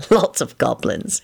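slow, understated knowing reply. A narrator's reading. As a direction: answer as the one who knows. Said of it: "he replied knowingly".

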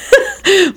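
short high laugh; real laugh. Real, not acted. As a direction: crack up at your own slip. Narrator laughs at own slip.

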